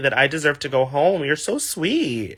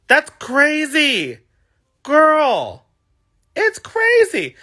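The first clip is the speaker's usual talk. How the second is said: high, slow sassy exclamation; sassy disbelief; 11 semitones higher, 3 dB louder, much slower than usual. Real, not acted. Sassy high-pitched disbelief at trolls to viewers.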